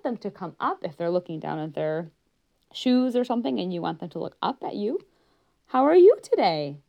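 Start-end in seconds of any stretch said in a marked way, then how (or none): 5.7-6.8 high, loud bright greeting. Shown as a demonstration. Therapist calling a child to look up, high and loud. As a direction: pitch it up to lift their gaze. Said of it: "might bring their attention up".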